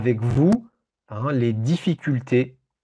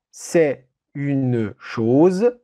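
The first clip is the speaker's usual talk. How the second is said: dictating slowly; much slower than usual. Shown as a demonstration. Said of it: "Je répète cette phrase".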